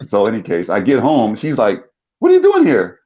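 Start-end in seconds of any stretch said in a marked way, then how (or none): 2.2-3.0 high, loud mock startled mother; startled mom. Mom sees her son walk in unannounced, high loud surprise. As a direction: shriek it as a surprised mom would.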